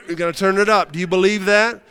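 acted tormentor's challenge; taunting challenge. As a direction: dare them as you crank up the pressure. Acts Satan taunting the believer.

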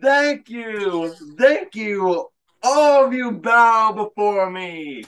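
high, slow and grandly pompous; pompous gracious champion. Pretend. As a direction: lord it over them like a king. Winner gloats, mock royal.

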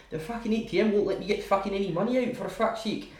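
put-on high-pitched, ranting Scottish accent. Drunk at the cash machine annoying the lads, Scottish accent. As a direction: put on an aggrieved Scottish squawk. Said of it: "start doing the Scottish accent".

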